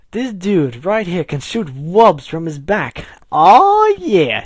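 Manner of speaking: loud, slow hype building to a high whoop; excited bragging. A narrator's reading. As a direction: show him off, ending in a huge cheer. Final brags about alien, cheering.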